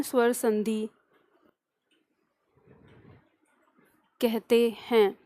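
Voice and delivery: slow dictation with long pauses between phrases. Acted. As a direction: dictate word by word for note-takers, drawing out each sound. Dictates definition to class. (धीमे-धीमे, रुक-रुक कर श्रुतलेख)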